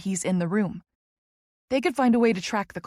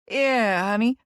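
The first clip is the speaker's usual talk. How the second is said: Kentucky drawl; 3 semitones higher, 4 dB louder, slower than usual. Acted. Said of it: "he calls in his thick Kentucky drawl".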